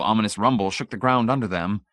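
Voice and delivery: ordinary delivery, nothing marked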